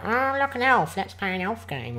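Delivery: high squeaky elf voice; chirpy fantasy elf. Retold or imitated. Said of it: "Just like my elf impression now".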